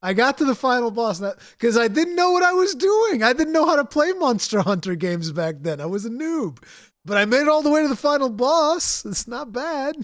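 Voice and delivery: high, loud, laughing protest; defensive laughing. Real, not acted. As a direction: defend yourself while laughing. Defensive, laughing self-justification.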